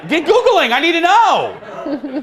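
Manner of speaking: high, loud laughing plea; laughing demand. Real, not acted. Host urging co-host Phil to google it, laughing excited plea. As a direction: laugh and beg him to search. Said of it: "urging Phil to search".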